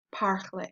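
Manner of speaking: "parler" said as par-lay, French R; pronounced final E. Shown as a demonstration. Teacher repeating the accented example, AY ending on "parler". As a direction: finish "parler" on an open AY. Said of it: "That's how we get the A sound".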